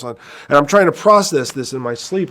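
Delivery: usual speaking voice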